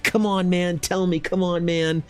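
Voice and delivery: high, impatient fan begging; mock pestering, pitched well up; imitated eager pleading. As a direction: plead like an over-excited fan. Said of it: "so excited and enthusiastic".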